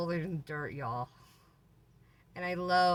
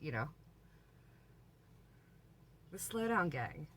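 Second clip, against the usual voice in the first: ordinary delivery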